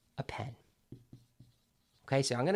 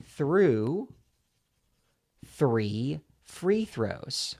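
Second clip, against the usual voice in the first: slow and deliberate; much slower than usual. Shown as a demonstration. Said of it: "do this with two other tongue twisters".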